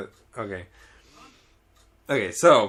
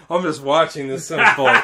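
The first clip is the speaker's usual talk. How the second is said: normal talking voice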